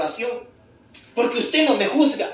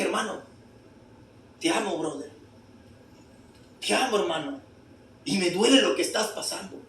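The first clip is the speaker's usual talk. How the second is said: tender; 5 semitones lower, 9 dB quieter than usual. Retold or imitated. Pastor comforts weeping brother, retold.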